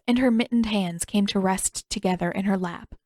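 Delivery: ordinary delivery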